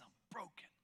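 barely breathed, all defences down; hushed whisper. Retold or imitated. Father admitting to Jesus he is broken, whispered. Man begging Jesus, desperate.

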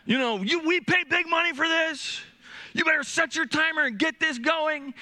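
high, loud call up the stairs; mock-bark at the kid from downstairs; imitated yell. Dad yelling up at the daughter to practice, a loud hammed-up call. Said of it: "from downstairs yelling up".

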